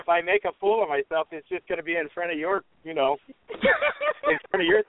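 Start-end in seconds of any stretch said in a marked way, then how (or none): 3.3-4.8 laughing. Real, not acted. Laughing while teasing interviewer.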